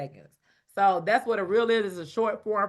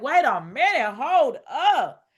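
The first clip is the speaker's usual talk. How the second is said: mock-outraged, louder and higher; a hint of a sassy double-take; indignant wait a minute; 5 semitones higher, 7 dB louder, slower than usual. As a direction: play up the retold outrage at her sister. Retold reaction to missing comments.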